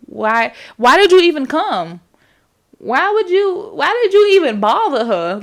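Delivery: exasperated outburst. Real, not acted. Viewer berating a movie character who swims away, exasperated yell. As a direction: yell at him in disbelief, pitch climbing high.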